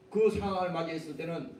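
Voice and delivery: usual speaking voice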